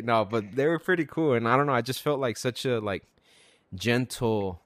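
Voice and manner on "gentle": normal talking voice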